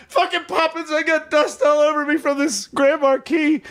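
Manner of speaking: high-pitched imitated complaint; mock exasperation. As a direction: gripe as the soot-covered kid. Retold sooty kid complaining.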